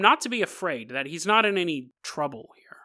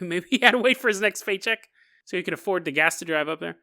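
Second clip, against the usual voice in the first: ordinary delivery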